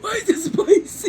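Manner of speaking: high, loud laughing whine; shrill complaint. Real, not acted. Sister loudly whining about the spicy drink. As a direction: yelp it out.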